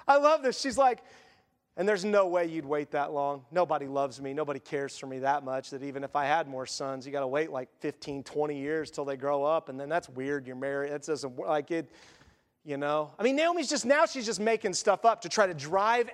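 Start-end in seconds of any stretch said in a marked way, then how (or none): 1.8-13.1 despairing Naomi. Acted. Preacher voicing Naomi to her daughters-in-law, low and defeated. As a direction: lower and softer, a defeated old widow.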